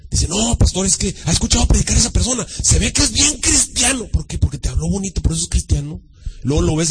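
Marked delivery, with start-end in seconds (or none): 0.3-4.1 higher mock-earnest voice, stretched at the end; admiring church member. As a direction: imitate a fan vouching for a preacher.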